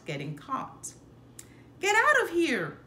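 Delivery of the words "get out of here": loud, high yell; angry yell. Acted. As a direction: chase the pup off at full volume. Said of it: "she'd yell".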